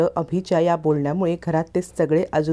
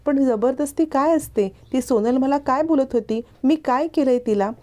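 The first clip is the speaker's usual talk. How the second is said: put-on confused childlike man; 8 semitones higher than usual. A narrator's reading. Childlike man asks his family what he is accused of, bewildered.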